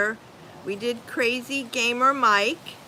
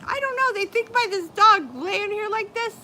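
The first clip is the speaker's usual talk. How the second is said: mocking a commenter; 12 semitones higher, 5 dB louder than usual. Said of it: "Good Vibes said".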